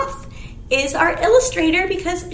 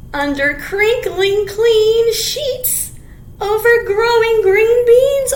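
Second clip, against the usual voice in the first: slow, louder storybook reading; reading to children; 3 semitones higher, 7 dB louder than usual. Acted. Teacher reading the picture book to kids. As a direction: read each phrase out with relish.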